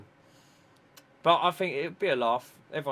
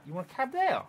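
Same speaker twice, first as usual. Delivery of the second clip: incredulous barman; 11 semitones higher than usual. Retold or imitated. Lost skier asks a French barman for a cab, voiced as mock disbelief. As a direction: pitch jumps way up on an astonished question. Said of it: "the geezer's looking at him like".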